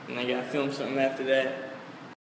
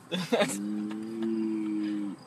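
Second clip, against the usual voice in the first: laughing; 8 semitones lower, 7 dB quieter than usual. Real, not acted. Laughing after cow scare.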